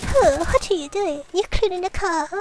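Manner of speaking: high, put-on accented comedy voice; jokey high-pitched character impression; Miss Swan. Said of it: "pull a Miss Swan sometimes".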